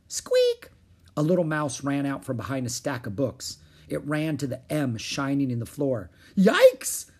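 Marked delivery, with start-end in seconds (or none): 0.1-0.7 very high, loud little squeak; sudden high-pitched rodent squeak; mouse squeak. A narrator's reading. Narrator voices the mouse. 6.4-7.1 high, loud yelp of surprise; sudden high squeal; startled cry. A narrator's reading. Annie startled by the mouse.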